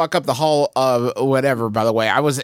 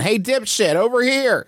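acted yell; 10 semitones higher than usual. Player yelling at the monster from the door, high mock yell. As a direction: call out to grab its attention. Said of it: "I just start yelling".

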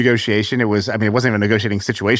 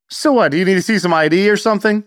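higher, cocky bluff as a dad; lightly voiced brazen dad; 7 semitones higher than usual. Dad pulling up to the gate guard, mock brazen. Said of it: "my dad just goes up".

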